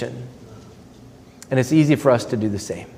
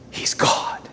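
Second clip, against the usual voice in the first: He's God whispered in hushed awe; 7 dB quieter than usual. Real, not acted. Preacher whispers the same claim.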